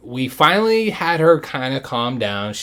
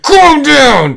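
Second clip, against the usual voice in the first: much higher, louder calm down; mock yell; 14 dB louder than usual. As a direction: yell it like the dad. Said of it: "Your dad was like".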